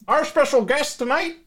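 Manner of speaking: announcer voice. Pretend. Announcer intro.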